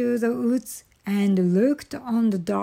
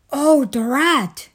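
loud, higher-pitched exclamation; mild storybook exasperation; exasperated Toad; 4 semitones higher, 6 dB louder than usual. A narrator's reading. Toad upset over sore feet and a lost button, exasperated.

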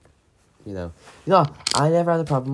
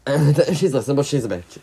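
louder, fast, laughing retake; giggly louder second go; 4 semitones higher, 8 dB louder, faster than usual. Shown as a demonstration. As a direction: blurt it out laughing. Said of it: "repeats louder".

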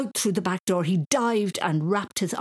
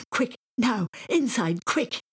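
higher, louder urgent push; urgent hurry; 6 semitones higher, 3 dB louder, slower than usual. A narrator's reading. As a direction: hurry him in, a child's urgency. Boy urging dad indoors.

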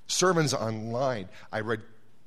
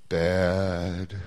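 sheepish answer; 8 semitones lower than usual. Acted. God quizzing Mike, Mike answers sheepishly.

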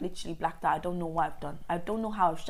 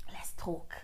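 whispered mock invitation; teasing her sister's habit in a hushed whisper; 3 semitones lower, 10 dB quieter than usual. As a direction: breathe it like a sly invite. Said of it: "she does this thing".

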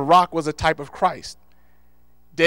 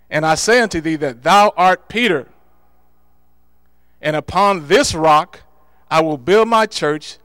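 loud, ringing sermon proclamation; preaching scripture; 6 dB louder than usual. Acted. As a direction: proclaim it to the whole church. Preaching Jesus' words to Peter.

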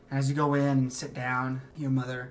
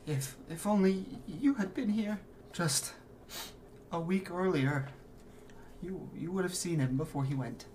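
holding back tears; 5 semitones higher than usual. Acted. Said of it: "still trying to hold back tears".

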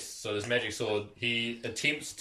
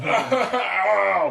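laughing ow; 8 semitones higher, 9 dB louder than usual. Real, not acted. Laughing at orc's wounds.

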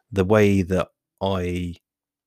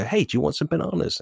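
friendly offering voice; 8 semitones higher, faster than usual. Acted. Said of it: "I kinda go".

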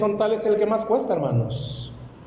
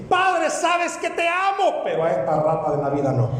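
hammed-up higher cry to God, then a quick laughing turn; mock pleading prayer; 6 semitones higher than usual. Churchgoer praying to God, then mocking the neighbour, hammed-up.

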